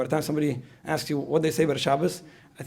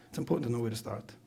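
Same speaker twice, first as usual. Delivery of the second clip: normal talking voice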